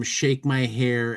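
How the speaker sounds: usual speaking voice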